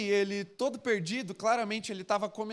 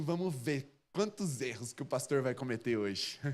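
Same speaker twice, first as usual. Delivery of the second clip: low, softer mock-critical voice; smug fault-finder; 7 semitones lower, 5 dB quieter than usual. Churchgoer readying to correct the pastor, mock smug and low. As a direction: imitate a churchgoer quietly sizing up the preacher.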